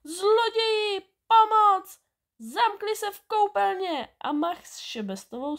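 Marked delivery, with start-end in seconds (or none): 0.0-2.0 very high, loud cry for help. A narrator's reading. Woman raising the alarm about thieves, screaming high and loud. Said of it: "vyběhla na chodbu a křičela". 2.4-4.1 high, raised alarm yell; crying out. A narrator's reading. Woman telling neighbours where thieves hid, high-pitched yell. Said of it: "a křičela".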